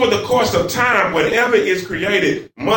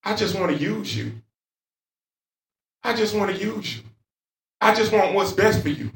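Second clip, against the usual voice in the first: much softer, gentle fatherly reassurance; sweet, tender God; 6 dB quieter than usual. Retold or imitated. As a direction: soothe him like a loving father, quietly. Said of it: "and it's so sweet".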